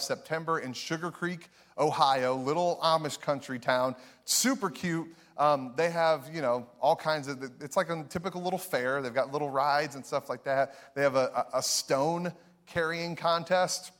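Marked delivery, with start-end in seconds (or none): none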